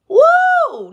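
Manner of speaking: high, loud cheer; whoop. Real, not acted. Reader cheering that judgment arrives, loud whoop.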